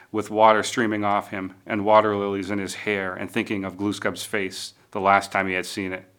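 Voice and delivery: ordinary delivery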